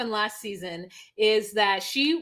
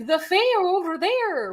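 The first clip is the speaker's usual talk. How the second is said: a higher, playful fake Irish lilt; mock Irish lilt; 10 semitones higher than usual. Mocking her friend's accent attempts: higher mock Irish lilt. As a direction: tease her with a fairy-tale Irish voice. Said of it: "you're like".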